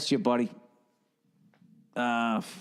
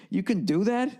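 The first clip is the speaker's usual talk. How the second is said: pitched much higher, retelling his amazement; a stunned, higher question; amazed disbelief; 10 semitones higher than usual. Retold or imitated. Retelling his surprise at Ralphie.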